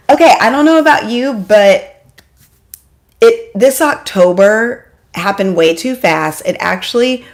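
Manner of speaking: ordinary delivery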